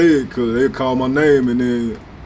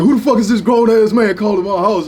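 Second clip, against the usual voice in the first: a higher, louder mock angry father; angry dad; 8 semitones higher, 3 dB louder than usual. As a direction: bark it like a protective dad answering the phone. Girl's angry dad on phone.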